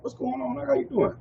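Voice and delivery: friendly greeting. Retold or imitated. Voicing his friendly greeting.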